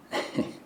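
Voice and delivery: short, breathy, self-satisfied chuckle; sinister chuckle. Acted. Killer amused by getting away with it, sinister chuckle. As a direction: chuckle darkly to yourself.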